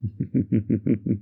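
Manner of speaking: short closed-mouth laugh. Acted. Amused before flattering reply.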